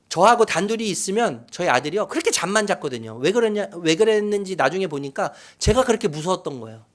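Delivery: normal talking voice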